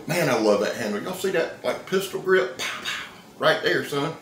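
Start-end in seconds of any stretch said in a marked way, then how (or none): 2.6-3.1 breathy whispered pow pow, soft; mock gunshots. Showing off the pistol grip, whispered mock gunshots. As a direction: mouth two quiet gun pops.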